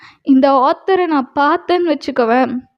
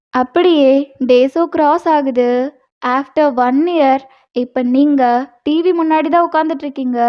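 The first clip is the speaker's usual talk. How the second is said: ordinary delivery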